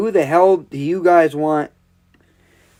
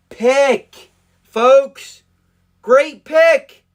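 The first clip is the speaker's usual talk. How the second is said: excited cheer; 7 semitones higher, 5 dB louder, much slower than usual. Real, not acted. Celebrating the pick.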